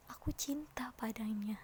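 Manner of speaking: very soft, hushed poem line. Shown as a demonstration. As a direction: drop the voice almost to a whisper. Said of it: "Rendah, rendahin aja".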